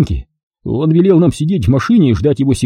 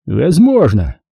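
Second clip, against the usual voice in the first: ухмылка; much slower than usual. Acted. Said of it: "ухмыльнулся Фокин".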